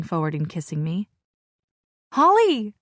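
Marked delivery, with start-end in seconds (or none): 2.1-2.7 calling out. Acted. Calling her name across the bar.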